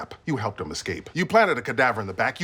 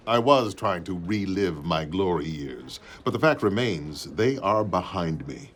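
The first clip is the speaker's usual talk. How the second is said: usual speaking voice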